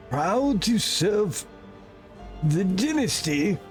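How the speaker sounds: high, slow, weak servant voice; feeble servant. Acted. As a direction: fade out as a dying servant. Voices dying factotum.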